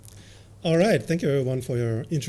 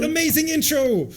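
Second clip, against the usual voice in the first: mock trailer voice; 12 semitones higher, 3 dB louder than usual. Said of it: "So imagine".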